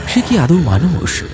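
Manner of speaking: low, grave storyteller voice; story narration. A narrator's reading. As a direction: narrate the ghost legend ominously. Narrator voice between scenes. (গম্ভীর গল্পকথকের বর্ণনা)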